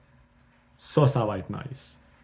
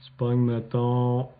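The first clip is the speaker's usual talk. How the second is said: usual speaking voice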